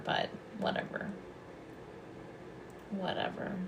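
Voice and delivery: a soft, dismissive whisper; under-breath aside. Real, not acted. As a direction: toss it off under your breath. Muttered aside to herself.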